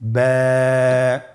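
ব্যা held very long and steady; drawn-out letter name. Shown as a demonstration. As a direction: stretch ব্যা out like a Quran teacher. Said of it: "আপনারা আমার সাথে আবার বলুন". (ব্যা অনেক লম্বা টেনে উচ্চারণ)

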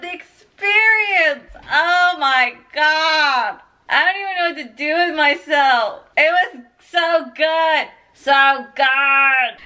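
slow, drawn-out emotional wail; tearful, emphatic gushing; overwhelmed raving. Real, not acted. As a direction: stretch every phrase as if spent with joy. Raving, overwhelmed after finishing.

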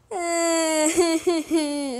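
loud, high mock sobbing; fake crying. Gamer who just lost, badly faked loud crying. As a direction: wail in pulsing pretend sobs. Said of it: "eu não tô chorando de verdade".

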